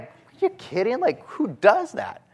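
high, slow disbelieving outburst; incredulous. Retold or imitated. Pastor recalling his shock at the stranger, high incredulous. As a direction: pitch way up in stunned disbelief. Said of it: "I was thinking".

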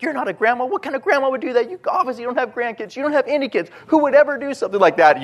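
high, fast scolding rant; indignant scolding. Retold or imitated. Bystander scolding the woman who screamed, high fast outrage. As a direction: tear into her with outrage. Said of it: "just got all angry at this lady".

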